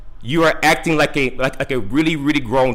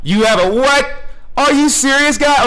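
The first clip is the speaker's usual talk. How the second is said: high-pitched real disbelief; incredulous; 10 semitones higher than usual. Real, not acted. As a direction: blurt it out, higher, as if you can't believe it. Caller reacts in disbelief to the sergeant.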